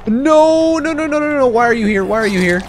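high, loud cry tumbling into repeats; panicked protest, easing slightly at the end. Real, not acted. Enemies suddenly appear during the fight.

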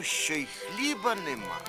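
usual speaking voice

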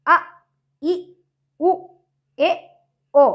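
அ, இ, உ, ஏ, ஓ each cut short; brief separate vowels with gaps between. Shown as a demonstration. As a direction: recite அ, இ, உ, ஏ, ஓ as quick short sounds. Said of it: "குறுகிய ஓசை உடையதால".

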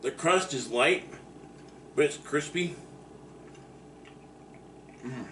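speaking between bites; chewing while talking. Real, not acted. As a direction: mouth partly full of food. Seen 2: Bit slice at -9; mouth still working food while speaking.

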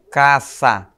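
"caça" said slowly with a sharp s, never a z; pron demo: s not z. Shown as a demonstration. Teacher to class, slowly sounding out "caça" with a sharp s. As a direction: draw out "caça", the middle a clean hissing s. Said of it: "Olha só".

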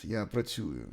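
ordinary delivery

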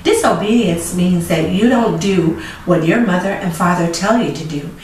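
ordinary delivery, nothing marked

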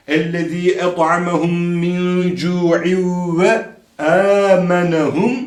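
slow, with long u in "cu'iv"; elongated recitation. Shown as a demonstration. Quran teacher modelling correct recitation, vowels held long. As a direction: draw out "cu'iv" and the opening of "âmenehum". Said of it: "O u'ya da dikkat edeceğiz".

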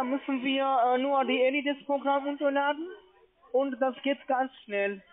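ordinary delivery

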